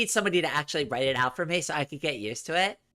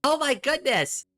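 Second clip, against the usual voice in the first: excited; 10 semitones higher than usual. Real, not acted. Thanks gifted memberships.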